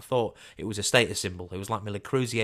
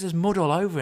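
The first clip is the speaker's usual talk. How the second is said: mocking cashier; 9 semitones higher, 5 dB louder than usual.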